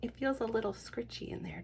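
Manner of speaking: plain reading voice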